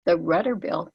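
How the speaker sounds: steady command with no need to argue; a calm, immovable demand; firm and calm. Retold or imitated. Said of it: "stands firm, holds presence".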